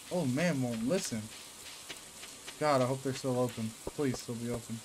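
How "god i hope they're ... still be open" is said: ordinary delivery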